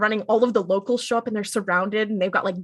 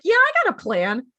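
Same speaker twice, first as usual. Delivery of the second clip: mock confidence; 8 semitones higher, 3 dB louder than usual. Acting out Dean's false confidence.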